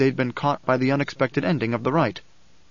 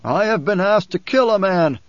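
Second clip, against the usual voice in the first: loud, higher, projected call; shouting; 6 semitones higher, 5 dB louder than usual. A narrator's reading. Man confesses to Alia from the crowd, shouting. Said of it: "a voice shouted from off to the right".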